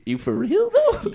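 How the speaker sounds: rising to a high squeak on "though"; incredulous, pitch climbing; mock disbelief. Asking the cops if they're serious, high and disbelieving. Police demand his clothes.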